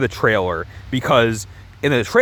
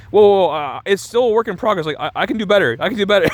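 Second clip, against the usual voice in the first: flustered backpedalling; 6 semitones higher than usual. Retold or imitated. Acts out singer panicking at her tears.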